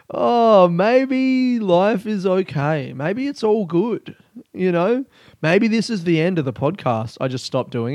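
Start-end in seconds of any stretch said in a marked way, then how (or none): 0.1-5.1 mock serenity. Suddenly blissful after the rant.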